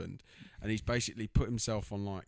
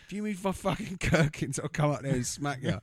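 much higher, louder mock threat, laughing through it; gherkin man threatening; 6 semitones higher, 5 dB louder than usual. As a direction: threaten him in a cartoonish voice, cracking up. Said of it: "He's like".

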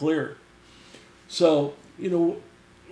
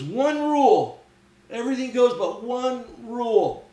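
stern rule-giver; 8 semitones higher, 5 dB louder than usual. Retold or imitated. Coop briefing paintball teams, emphatic warning. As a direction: imitate a host laying down the law.

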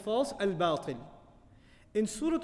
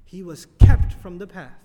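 slow and much louder, hammering kept; loud punchline; 16 dB louder than usual. Real, not acted. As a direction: drive home kept from the path. Punchline of the lesson.

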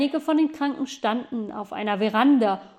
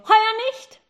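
high, loud call; boy calling down to the girl, high and loud; 11 semitones higher, 6 dB louder than usual. Acted. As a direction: shout it down to her. Boy calls down from above.

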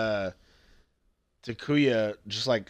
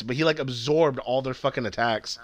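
normal talking voice

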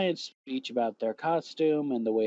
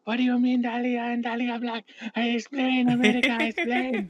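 high-pitched exasperated demand; high mock demand; 11 semitones higher than usual. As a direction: squeal it like an exasperated fan.

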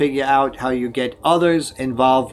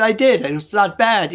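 pitched-up, mildly insistent retort; retold defensive protest; 6 semitones higher than usual. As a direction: defend yourself in a lightly acted comeback.

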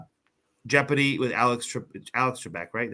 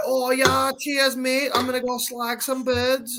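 high-pitched mock British reality-star voice; British accent; 13 semitones higher, slower than usual. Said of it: "British people's accents make everything ten times funnier".

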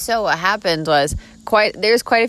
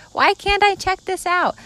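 put-on much higher innocent child question; innocent kid voice; 13 semitones higher than usual. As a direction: ask like a wide-eyed kid. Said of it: "you're just so innocent too".

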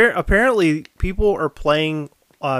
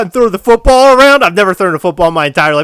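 excited; 6 semitones higher, 8 dB louder, much faster than usual. Pretend. Sudden eager outburst about football.

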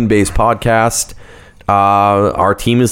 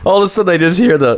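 laughing; 8 semitones higher, faster than usual. Real, not acted. Laughing recap of scream story.

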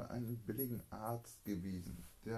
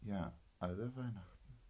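ordinary delivery

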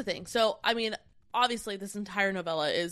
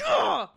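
a sharp, high, loud "ugh" of frustration; frustrated groan; 11 semitones higher, 4 dB louder than usual. Real, not acted. Reacting to the book's cruel twist, a loud high groan. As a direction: groan it out, fed up with the plot.

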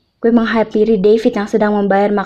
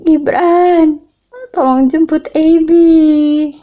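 snotty crying; 7 semitones higher, 4 dB louder, much slower than usual. Acted. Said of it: "sambil menangis sesek-segukan".

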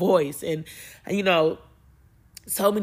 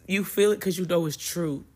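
ordinary delivery

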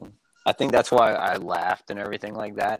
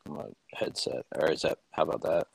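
ordinary delivery

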